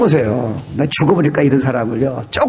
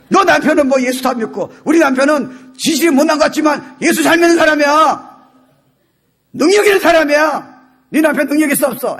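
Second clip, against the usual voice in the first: hammed-up boasting retort; 10 semitones higher than usual. Shown as a demonstration. Preacher acting a wife defending her husband, hammed-up indignant boast. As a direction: brag back with mock indignation. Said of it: "속으로 그렇게 얘기해야지".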